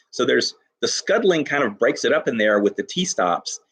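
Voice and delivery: ordinary delivery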